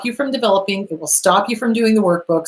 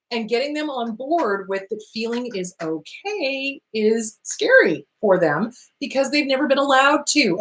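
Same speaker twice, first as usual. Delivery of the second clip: ordinary talk, nothing marked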